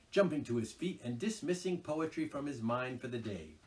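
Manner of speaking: ordinary delivery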